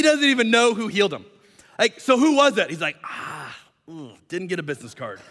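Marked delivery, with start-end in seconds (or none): 2.0-2.7 high, loud demand; pressing question. Acted. Leaders press the healed man for a name, demanding. 3.0-5.2 quiet, awkward admission; soft, hesitant excuse; sheepish. Acted. Healed man admits he has no name to give, sheepish.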